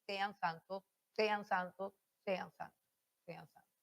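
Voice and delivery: repeated sean santos fading from quiet to almost silent. Shown as a demonstration. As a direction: let sean santos die away to nothing. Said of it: "todo el tono debe estar así".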